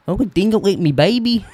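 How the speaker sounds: high, hammed-up Australian accent; a horrible attempt at an Australian accent; a man mocking an Australian accent badly. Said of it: "That was your Australian accent?".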